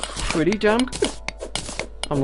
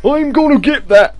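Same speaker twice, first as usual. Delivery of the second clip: higher, louder and eager; I'm gonna get that, punched up with excitement; excited repeat; 7 semitones higher, 8 dB louder than usual. Real, not acted. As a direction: grab it with glee.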